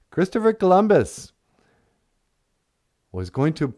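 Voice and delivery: ordinary talk, nothing marked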